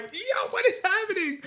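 high-pitched comic panic; acted-out startled squeal; mock alarm. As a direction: cry out in exaggerated disbelief, voice leaping up. Acting out shock in retold fight.

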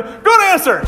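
a hint of a forced game-show cheer, high; Family Feud cheer. Preacher imitating game-show contestants, mock cheer. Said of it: "You know".

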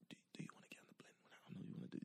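fast, panicked whisper; quick scared whisper; frantic whisper. Retold or imitated. Panicked aside to himself.

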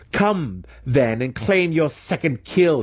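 high-pitched challenge, not shouted; goading dare. A narrator's reading. Mentor inviting his student to kill him, goading.

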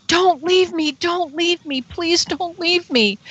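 high, drawn-out begging; pleading. Retold or imitated. Witness begging the departing craft to stay, pleading. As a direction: beg them not to go. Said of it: "I was almost pleading, like begging".

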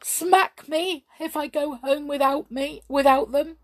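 high, gasping, tearful wail; hammed-up sobbing little boy; weeping boy. A narrator's reading. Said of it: "wept the boy".

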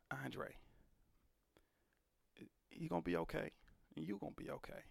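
gentle, hushed comfort from a stranger; a kind stranger quietly reassuring; soft reassurance. Retold or imitated. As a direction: soothe him quietly. Stranger comforting him in hospital.

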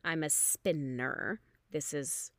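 usual speaking voice

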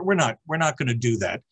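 usual speaking voice

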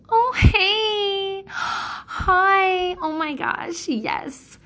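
exaggerated sugary mom greeting a friend; fake sweet greeting. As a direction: put on the phony nice-lady act for a friend. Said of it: "she doesn't talk like that".

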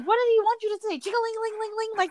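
mock the absurd question with exasperated disbelief; incredulous mockery. Mocking the man who wanted another name, incredulous and high.

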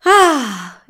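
loud, high burst of joy; a knight thrilled at the sight; delighted exclamation. Acted. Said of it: "esclamò Don Chisciotte".

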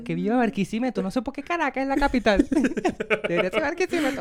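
loud, high mock hometown cheer; fanático de Barquisimeto. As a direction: crow for your city, half laughing. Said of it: "cuando yo estoy con Barquisimeto". (arenga regionalista aguda y fuerte)

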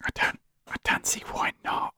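breathy hushed whisper. Real, not acted. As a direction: keep it down, he's outside. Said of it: "just whisper".

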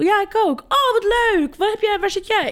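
lightly voiced high friend; high-pitched mock chirpy friend; Nigel voice. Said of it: "En toen zei, eh, Nigel".

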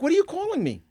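high-pitched mock indignation; mock offended. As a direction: act insulted, but have fun with it. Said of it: "I'm gonna take offense to it".

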